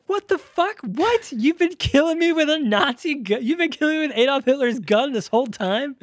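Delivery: hammed-up high-pitched incredulous shriek; mock outraged squeal. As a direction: play him shrieking in shocked disbelief at the gun. Roy's outraged reaction in retold scene.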